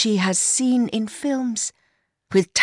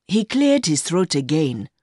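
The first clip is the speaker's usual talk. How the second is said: usual speaking voice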